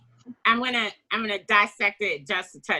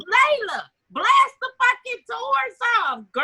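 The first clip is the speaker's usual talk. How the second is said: high, louder cheer for Layla; cheering shout; 14 semitones higher, 4 dB louder than usual. Retold or imitated. Fan urging Layla to blast the doors, excited high shout. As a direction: egg her on like a cheerleader. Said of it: "I was like".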